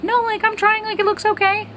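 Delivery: defensive. Retold or imitated. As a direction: cover up the bad job, voice jumping up. Defending her bad haircut.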